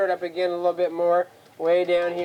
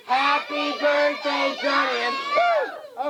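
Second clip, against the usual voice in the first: high, slow birthday call; high-pitched chant ending in a squeaked poof; group shout; 8 semitones higher, much slower than usual. Pretend. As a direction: lead the kids in a drawn-out magic chant. Leading children in magic words.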